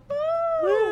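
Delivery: high, loud mock "woo"; imitated gleeful woo, held out; excited whoop. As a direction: let out a playful high-pitched whoop. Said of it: "we can go".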